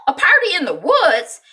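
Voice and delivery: high, loud mock exclamation; exasperated disbelief. Mother reacting on the phone to news of a party, loudly incredulous. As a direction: belt it out in high-pitched disbelief.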